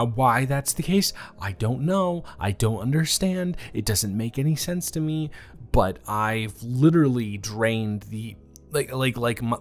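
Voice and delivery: ordinary delivery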